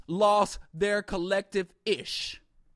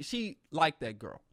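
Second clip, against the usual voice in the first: usual speaking voice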